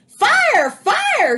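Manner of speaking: very high, loud alarm cry; cat shouting alarm. A narrator's reading. Jack yells at the birds, very high and loud. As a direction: raise the alarm to scare them. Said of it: "he cried".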